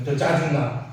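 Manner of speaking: normal talking voice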